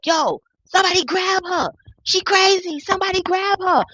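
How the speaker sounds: high-pitched imitation of a fleeing man; mock screaming for help. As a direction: mimic his panicked plea to bystanders.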